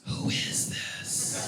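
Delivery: slow, drawn-out whisper; menacing whisper. Retold or imitated. Marie confronting the unknown caller, slow whisper. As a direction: whisper it slowly, every word stretched. Marie demands to know who's calling.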